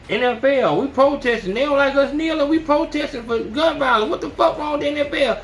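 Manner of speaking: mock protester rant. Mocking protesters' outrage.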